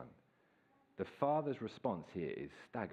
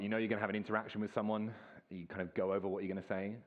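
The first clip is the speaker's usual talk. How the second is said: usual speaking voice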